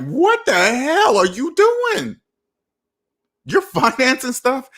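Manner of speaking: high, slow, stunned what-the-hell; incredulous disbelief. Retold or imitated. Reacting to Facebook posts of financed cars, incredulous. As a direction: act out shocked disbelief at people's choices. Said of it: "I'm like".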